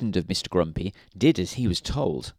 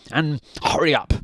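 snapped; 5 semitones higher, 3 dB louder than usual. A narrator's reading. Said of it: "snapped Mister Grumpy".

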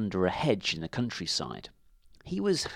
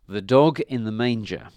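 ordinary delivery

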